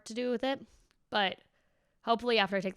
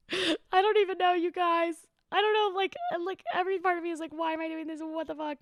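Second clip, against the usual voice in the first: higher, half-joking whine; a comic whine, higher and strained; whiny high voice; 9 semitones higher than usual. Real, not acted. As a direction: whine it out about the move. Whining about her fears.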